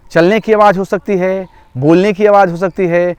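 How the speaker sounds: normal talking voice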